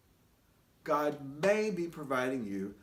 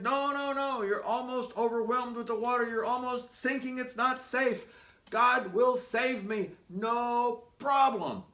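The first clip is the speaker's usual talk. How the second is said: stubborn refusal; 7 semitones higher, 5 dB louder than usual. Acted. Man on roof refuses boat.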